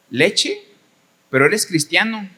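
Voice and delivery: shocked boss. Retold or imitated. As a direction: play the appalled boss, pitch jumping on leche. Boss shocked at milk order.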